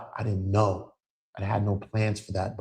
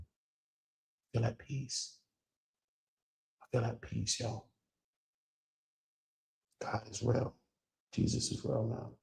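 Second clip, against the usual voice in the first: much softer, slow and gentle; hushed and peaceful; 3 semitones higher, 12 dB quieter, slower than usual. Real, not acted. Telling viewers he feels at peace, hushed gentle voice. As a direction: let it fall to a near hush. Peace abroad, soft reflection.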